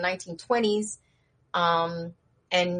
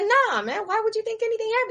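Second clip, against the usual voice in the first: fast high denial; 14 semitones higher, much faster than usual. Acted. Jokester denies anything went wrong.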